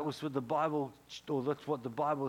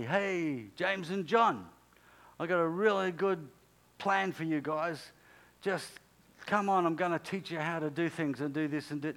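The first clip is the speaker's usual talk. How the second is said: louder, hearty calling-out; mock eager pitch; 4 semitones higher, 4 dB louder than usual. Jesus imagined pitching plans to James and John, mock eager call. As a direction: hail them like you're selling a great plan.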